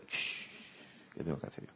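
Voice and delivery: whispered shh, then a low murmured question; hushed inner voice. Pretend. Telling oneself to hush and look inward, whispered. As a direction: hush yourself and ask under your breath.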